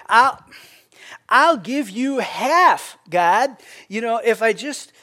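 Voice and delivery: slow, drawn-out greedy offer to God; greedy bargaining prayer. Retold or imitated. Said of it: "the next one says".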